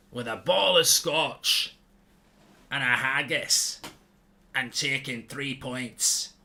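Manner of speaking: slow, hammed-up Scottish accent. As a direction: keep up the mock Scotsman, ranting about the match. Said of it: "I gave you a Scottish accent".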